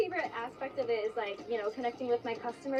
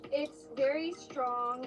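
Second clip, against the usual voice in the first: very drawn out slowly; stress on very; much slower than usual. Real, not acted. To her teammate about the dough, slow stress on very. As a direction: lean hard and slow on very.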